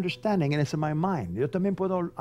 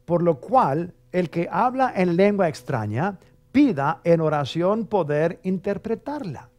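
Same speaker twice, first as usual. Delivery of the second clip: louder solemn Bible reading; scripture reading; 3 semitones higher, 5 dB louder, slower than usual. Shown as a demonstration. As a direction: read the verse out with weight. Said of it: "estoy leyendo la palabra de Dios".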